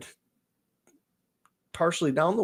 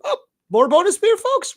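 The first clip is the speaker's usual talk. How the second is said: very high, loud, sing-song voice; imitating bartender; 6 dB louder than usual. The bartender offering more free beer to the couple, very high and sing-song. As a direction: ham up a chirpy waitress.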